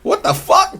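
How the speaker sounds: high-pitched incredulous outburst; short, high cry of disbelief; incredulous henchman. Acted. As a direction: squeak it out in disbelief. Henchman's startled reaction acted out.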